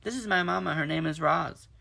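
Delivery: high, loud call. Acted. Gosling introducing his mother to otters, shouting. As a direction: keep shouting it across the water. Gosling calling over waves.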